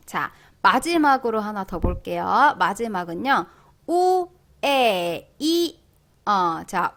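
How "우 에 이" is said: 우, 에, 이 each held apart; very slow 우, 에, 이 as three separate vowels; vowel breakdown. Shown as a demonstration. Teacher spelling out the sound, vowel breakdown. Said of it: "이렇게 발음이 되는데요".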